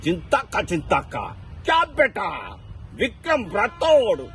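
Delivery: high, slow, menacing film villain; Babuji voice. Retold or imitated. Mimicry artist on stage doing the Vikramarkudu villain, high and slow. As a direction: growl out the villain's threats in a high strained voice. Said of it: "Babuji voice".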